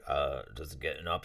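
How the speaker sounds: low, soft and sleepy; half-asleep reply to an early phone call; groggy just woken. Retold or imitated. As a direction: mumble it like you just woke up. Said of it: "I'm like".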